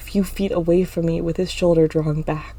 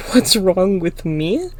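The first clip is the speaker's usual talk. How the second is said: louder, incredulous question; mocking laugh; 5 dB louder, slower than usual. A narrator's reading. Clinton turns Emma's question back on her, mocking. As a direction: throw it back with scorn. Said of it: "Clinton laughs".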